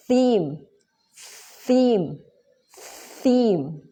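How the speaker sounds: "theme" with the tongue between the teeth. Shown as a demonstration. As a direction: breathe "theme" out over the tongue. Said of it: "that is".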